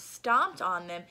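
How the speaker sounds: usual speaking voice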